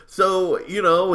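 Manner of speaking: normal talking voice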